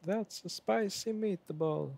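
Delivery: put-on high, slow Italian grandma accent; playful Italian grandma accent, high and slow; funny grandma accent. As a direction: ham up grandma's Italian accent on spicy meatball. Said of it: "With the funny accent".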